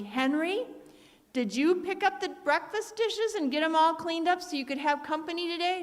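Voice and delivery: a hint of an exasperated wife calling out; lightly voiced mother, scolding from the doorway; nagging mother.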